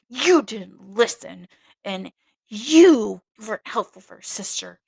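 loud, slow, tight-lipped reproach; tight-mouthed scolding. Retold or imitated. Novice mistress reproaching her, loud and tight-lipped. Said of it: "her mouth would get all tight".

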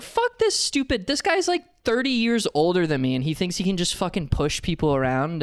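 high-pitched exasperated rant, easing down as it goes; retold annoyance. Venting about the older guy who pushed, annoyed rant. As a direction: replay a worked-up, indignant complaint. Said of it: "I was just so fucking annoyed with this guy".

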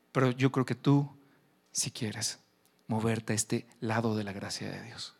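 ordinary delivery

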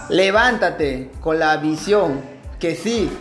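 booming motivational speech. Acted.

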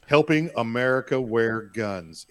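ad slogan. Acted.

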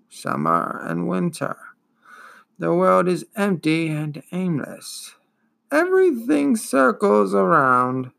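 put-on slow, wheezing, world-weary old beast; weary ancient turtle. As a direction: let every word sag with ancient boredom. Turtle's long weary speech.

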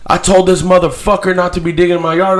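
a touch of an irate neighbor lady; angry lady. Retold or imitated. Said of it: "she's like".

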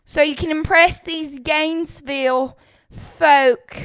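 put-on country accent. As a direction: rant in an over-the-top country accent. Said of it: "I'm trying to get the country accent so hard".

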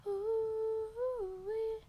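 high sing-song ooh-wee; excited squeal. Pretend. Silly exclamation.

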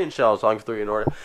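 ordinary delivery

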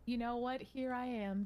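usual speaking voice

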